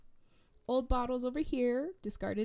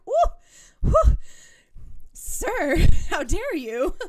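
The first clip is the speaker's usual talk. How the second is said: high, loud flustered gasp; hammed-up flustered lady fanning herself; mock scandalized swoon; 8 semitones higher, 16 dB louder, slower than usual. Gamer mocking shock at underwear, loud flustered swoon. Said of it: "I was like".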